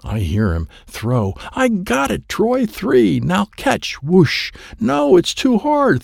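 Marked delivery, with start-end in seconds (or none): 1.5-6.0 put-on high, excited shouts of a kid at play; excited child. Kid calling to Dad during a game of catch, excited. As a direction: call it out in a high, eager voice.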